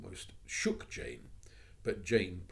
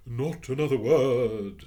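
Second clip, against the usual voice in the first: jolly matron; 6 semitones higher, 15 dB louder, slower than usual. Acted. Changed Biddle, jolly.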